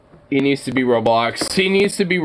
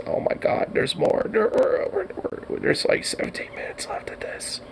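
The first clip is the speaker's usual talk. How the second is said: whispered dismay; 6 semitones higher, 9 dB quieter than usual. Real, not acted. Dismay at video length.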